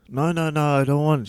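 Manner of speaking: high-pitched, hurried mock protest. Refusing insistent cleaner.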